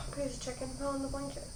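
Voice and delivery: unvoiced whispered aside. Real, not acted. Vlogger whispering to herself over dropped food, whispered. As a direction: breathe it out under your breath.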